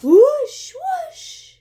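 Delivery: high, airy swooping whoosh; ghost whoosh. Shown as a demonstration. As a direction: swoop through the air like a ghost. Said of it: "Ghosts swoop".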